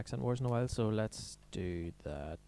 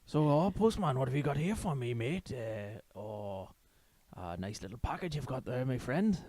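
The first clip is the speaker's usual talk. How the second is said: put-on chirpy old Welshman; 3 semitones higher than usual. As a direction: greet the postman like a cheery Welsh granddad. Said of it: "a chirpy old Welsh man".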